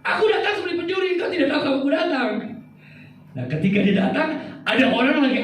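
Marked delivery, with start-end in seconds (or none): 0.0-2.3 higher, fast, teasing and half-laughing; playful Jesus quote. Acted. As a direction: rattle it off lightly like a playful tease. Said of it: "Ini ibaratnya". (Yesus yang datang tiba-tiba, diucapkan cepat, tinggi, sambil tertawa)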